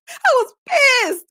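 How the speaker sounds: high, loud and slow through laughter; laughing outrage. Real, not acted. Host cracking up over soggy chicken strips, loud and slow. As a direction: lean into the outrage while laughing.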